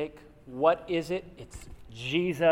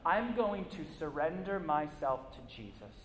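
fading from firm resolve to a hushed, softer prayer; sincere surrender; 6 dB quieter than usual. Retold or imitated. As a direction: give yourself over, quieter with each phrase.

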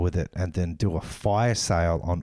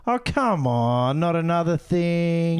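drawn-out, higher-pitched mock complaint; exasperated groan; 7 semitones higher, slower than usual. Co-host acting out a viewer's reaction, exasperated. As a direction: stretch each phrase like a fed-up viewer.

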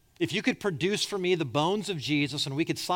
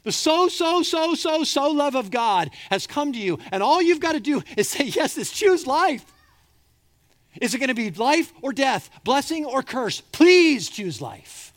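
much higher, much louder, begging with urgency; pleading God; 11 semitones higher, 10 dB louder than usual. Acted. God pleading with his people to choose life, loud. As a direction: cry out like a desperate loving father. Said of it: "He's sitting up there saying".

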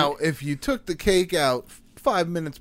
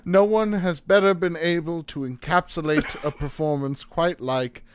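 mock awards announcer; 3 semitones lower than usual. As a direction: parody of an Oscars presenter introducing an actor. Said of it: "a feature at the Oscars".

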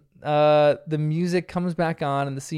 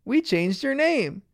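put-on higher, slow childlike teasing; teasing girls; 7 semitones higher, slower than usual. The girls tease their dad, playful. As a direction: voice cheeky little girls. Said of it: "the girls say".

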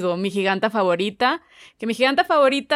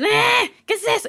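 mocking shopkeeper voice; 11 semitones higher than usual. Shopkeeper refusing big bill.

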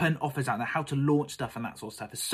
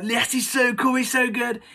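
imitating fans gushing; 9 semitones higher, 4 dB louder than usual. People gushing about the speaker.

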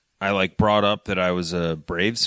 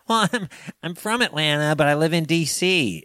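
imitating a woman; 7 semitones higher than usual. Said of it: "And she's like".

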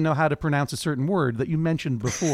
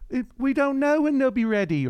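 put-on failed Baldric accent; 8 semitones higher than usual. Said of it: "I can't do that accent".

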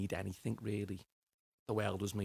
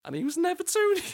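laughing; 11 dB louder than usual. Real, not acted. Punchline of his own story.

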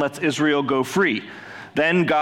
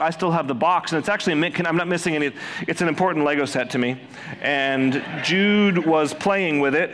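ordinary talk, nothing marked

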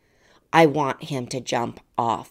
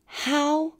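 self-scolding. Retold or imitated. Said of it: "all I thought was".

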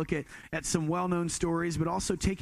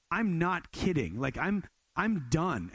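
slow insistence; slower than usual. Retold or imitated. Insisting to friend.

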